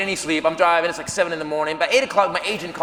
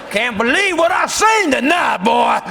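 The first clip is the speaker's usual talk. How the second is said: loud, higher angry southern boss; pissed-off Boss Hog; 4 semitones higher, 4 dB louder than usual. Retold or imitated. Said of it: "he is pissed off".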